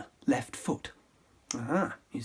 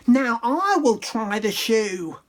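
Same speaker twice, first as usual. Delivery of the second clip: slow, loud demanding yell; slow high bossy shout; 6 semitones higher, 4 dB louder than usual. Acted. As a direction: insist on her turn. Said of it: "who yelled".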